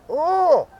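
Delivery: high, loud put-on Chinese accent, imitating a waitress; hammed-up Chinese accent for the restaurant server; mock Chinese accent. Teasing the restaurant server in a mock Chinese accent. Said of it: "use an accent talking back to 'em".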